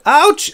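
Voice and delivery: high, loud mock "ouch"; short hammed-up yelp of pain. Storyteller voices wraith stabbed in the foot, high mock yelp.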